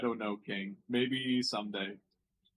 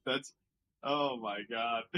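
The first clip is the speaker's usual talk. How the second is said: ordinary delivery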